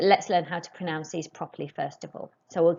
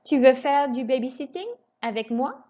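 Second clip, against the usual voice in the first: higher, careful model, moi lifts; rising question tune; 6 semitones higher, 4 dB louder than usual. Shown as a demonstration. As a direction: finish on a rise at moi. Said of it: "raise your voice just before the question mark".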